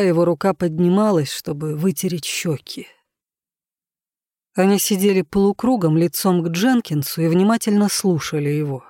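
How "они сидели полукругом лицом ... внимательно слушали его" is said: ordinary delivery, nothing marked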